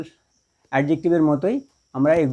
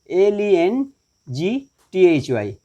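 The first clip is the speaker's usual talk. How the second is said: slow, letter-by-letter spelling; 3 semitones higher, much slower than usual. Shown as a demonstration. Teacher spells lengthy for the class, slowly letter by letter.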